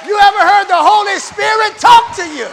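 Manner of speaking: high, very loud shouted question; full-force pulpit shout; fervent preaching shout. Real, not acted. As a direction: cry it out to the whole congregation. Preacher calls out to congregation.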